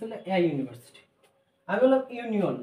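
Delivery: usual speaking voice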